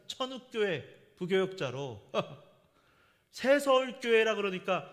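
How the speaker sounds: normal talking voice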